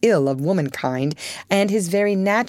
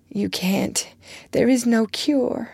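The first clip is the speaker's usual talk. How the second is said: voice giving way; 7 semitones higher than usual. Acted. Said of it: "There Beth's voice gave way".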